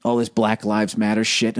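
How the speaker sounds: ordinary talk, nothing marked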